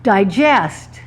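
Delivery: "Digest" stressed on the second syllable; "Digest" as a verb, end-stressed. Shown as a demonstration. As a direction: say "Digest" as die-JEST. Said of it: "I'm gonna reverse it on you".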